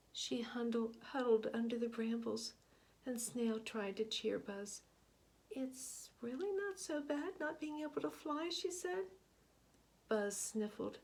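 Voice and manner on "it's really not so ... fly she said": Snail's voice. Acted.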